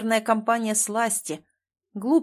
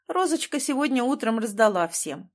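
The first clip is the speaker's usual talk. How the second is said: ordinary delivery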